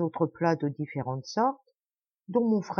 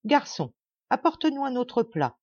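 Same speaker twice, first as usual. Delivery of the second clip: raised, higher call to the waiter; exclaiming; 6 semitones higher than usual. A narrator's reading. The host calls the waiter for a new dish, exclaiming. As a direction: call out grandly for another dish. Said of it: "s'écria".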